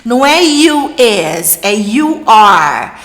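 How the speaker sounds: mild mock nitpicking critic, slow; mocking hater. Professora imitando os críticos das alunas, devagar. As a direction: imitate a hater correcting grammar. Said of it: "os haters da vida me mandam".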